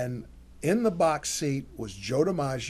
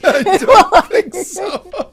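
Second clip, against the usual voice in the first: loud, laughing refusal; helpless laughter breaking up the words; 18 dB louder, slower than usual. Real, not acted. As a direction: say it through hard laughter. Both laugh at the retold refusal.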